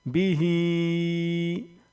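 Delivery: slow, drawn-out chanted recitation; Quran recitation. Shown as a demonstration. Teacher reciting the finished word for students, slow chant. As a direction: intone the word like Quran tilawat.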